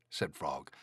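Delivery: plain reading voice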